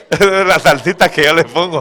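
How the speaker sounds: high, loud chuckling aside; laughing aside. Real, not acted. Preacher laughing at his own blunt wording to the church. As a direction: laugh at your own bit of spice.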